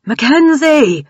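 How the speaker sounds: high loud calling out her name; urgent call. A narrator's reading. Grant yelling for Mackenzie to climb, urgent call. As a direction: yell to get her moving. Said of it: "Grant's voice compelled her".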